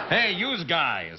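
very slow, drawn-out mock American; bad American accent. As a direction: stretch it out like a Brit faking American. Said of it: "you get a little bit of that".

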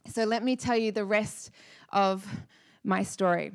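ordinary talk

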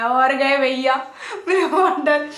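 laughing. Real, not acted. Laughing about her fear.

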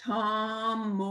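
slow, drawn-out word split into syllables; syllable-by-syllable demo. Shown as a demonstration. Teacher showing parents how to count syllables, slow and drawn out. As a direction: stretch each syllable so the chin drops. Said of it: "How many times does your chin drop".

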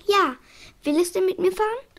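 excited, pleased surprise; surprised. Acted. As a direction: gasp it out in happy disbelief. Astonished doctor asks eagerly.

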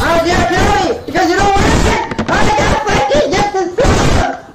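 very high frantic yelling; screaming outburst. Real, not acted. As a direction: shriek it all out at the top of your range.

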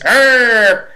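high mock drill growl; a sharp barked "Arr!" like a drill captain; captain's growl. Captain's voice pushing him at the gym, mock growl. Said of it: "I hear this".

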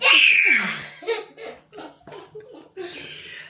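loud high scream breaking into laughing; shriek, then crack up; startled shriek into laughter. Real, not acted. Parent found by surprise in hide and seek, shrieking laugh.

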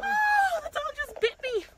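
high-pitched cry of alarm; real startled shriek, easing slightly; shocked pained cry. Real, not acted. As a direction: cry out in shock at being bitten. Dog bites her during livestream.

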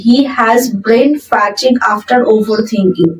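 very slow, word by word dictation; slow model sentence. Shown as a demonstration. Teacher reading the example sentence aloud to students, very slow and clear. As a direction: spell it out slowly for learners. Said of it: "চিন্তা করতে করতে ওর মস্তিষ্কে পচন ধরেছে".

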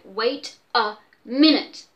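emphatic wait. Acted. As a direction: stop him firmly.

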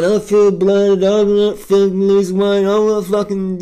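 high, slow mock whine; whining vegans. Vlogger mocking vegan Facebook groups, slow whine. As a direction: drag it out like a moaning vegan. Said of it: "they were just fucking whine".

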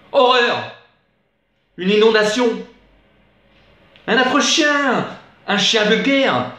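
high, theatrical cry of horror; mock-tragic high-pitched outburst; horrified outcry. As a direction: exclaim in dismay, drawing out the words. Recited shock at the dog.

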